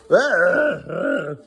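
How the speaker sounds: usual speaking voice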